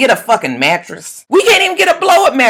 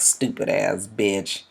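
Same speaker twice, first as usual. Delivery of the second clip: low, much softer, slow mutter; muttered insult; 14 semitones lower, 12 dB quieter, slower than usual. Retold or imitated. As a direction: mutter it under your breath with contempt. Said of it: "he just looks at her like".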